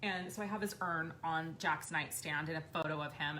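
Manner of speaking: usual speaking voice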